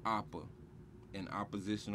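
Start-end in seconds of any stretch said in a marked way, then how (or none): none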